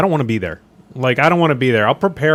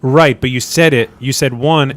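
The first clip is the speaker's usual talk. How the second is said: normal talking voice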